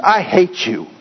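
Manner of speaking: understated angry yell; screamed. A narrator's reading. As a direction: hit the I, a child's retold outburst. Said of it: "shook his fist and screamed".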